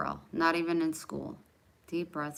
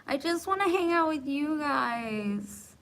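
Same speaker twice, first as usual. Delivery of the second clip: sweet plea; 7 semitones higher, 5 dB louder than usual. Acted. Begs viewers to stay.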